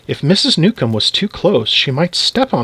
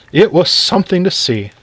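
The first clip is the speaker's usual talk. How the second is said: usual speaking voice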